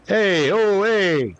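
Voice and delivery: put-on loud New York Italian accent greeting; big New York Italian hey. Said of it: "New York Italian accent".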